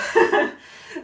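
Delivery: short loud laugh. Real, not acted. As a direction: let out a quick laugh. Laughs before shaking cocktail.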